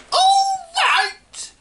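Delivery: very high, loud screeching retort; old woman yelling. Acted. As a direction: fling the words back furious. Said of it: "she yelled".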